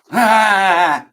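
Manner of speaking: loud, high, held "ah" cry; mock scream. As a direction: cry out after your own joke. Mock cry after butchering name.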